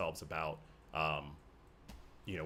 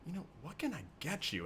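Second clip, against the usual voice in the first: cool bartender; 6 semitones higher, 6 dB quieter than usual. Acted. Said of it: "he's just like".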